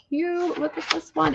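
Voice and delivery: high, cooing delight; gushing. Real, not acted. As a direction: coo it high over something tiny. Gushing over another cute book.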